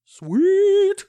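very high, loud, stretched cheer; squealed approval. Pretend. Co-host hails the release plan, squealed high. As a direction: shoot way up high and hold it. Playful reaction to release news.

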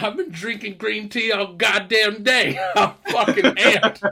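hammed-up jittery brag after a trash-can hit; amped up. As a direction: hype yourself up like you're buzzing on caffeine. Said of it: "He's like".

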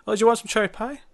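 high, lilting question as a waitress; waitress offering. Retold or imitated. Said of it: "the girl who works there is like".

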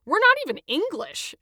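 loud, high disbelieving outburst; can't believe what he's hearing; Adam in loud disbelief at the gloating checker; incredulous. A narrator's reading. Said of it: "Adam said incredulously".

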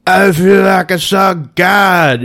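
slow dazed awe, shouted out; spaced-out stoner marvelling; stoned voice. Retold or imitated. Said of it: "stoned per".

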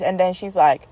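ordinary talk, nothing marked

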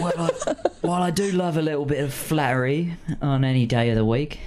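gruff Cockney bird, teasing; Cockney accent. Acted. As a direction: crow speaking in a Cockney accent. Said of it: "my Cockney accent".

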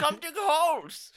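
very high, loud, drawn-out call; mock advert sign-off shout; hammed-up advert pitch. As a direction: holler it slowly like a commercial slogan. Mock company advert, calling out.

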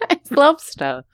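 high, slow put-on voice; drawn-out mock professor answer; Jordan Peterson. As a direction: answer in a strained silly impression. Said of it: "Jordan Peterson, uh, what is your favorite animal".

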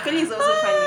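high, loud laughing praise. Real, not acted. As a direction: laugh out the compliment.

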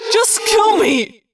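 high, loud, drawn-out plea; slow high-pitched shriek; agonized scream. A narrator's reading. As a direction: scream it as a desperate, wounded creature. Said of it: "he screamed".

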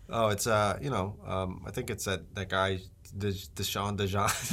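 low, very soft, halting reply; reluctant crew member. Acted. Venue worker naming Saturday's act, low hesitant mumble.

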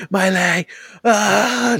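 high-pitched retold wail; hammed-up shriek of pain; mock pained scream. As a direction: cry out in exaggerated agony, imitating the victim. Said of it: "She was screaming".

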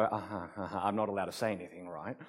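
very soft, fast mumbled uh-huhs; mock meek agreement. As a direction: nod along quietly, saying nothing. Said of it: "And I sit there and go".

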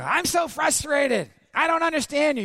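high, loud mock outburst; acted frustration. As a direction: play a frustrated husband wailing his complaint. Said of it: "So you don't go".

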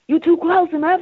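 high, loud yelling warning; mock Samuel L. Jackson yell. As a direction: shout at the intruder. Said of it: "he just yell out".

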